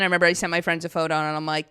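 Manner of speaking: ordinary delivery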